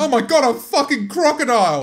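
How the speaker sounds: loud high fake alarm; mock panic. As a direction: shriek like you spotted a crocodile.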